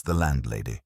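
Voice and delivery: ordinary delivery, nothing marked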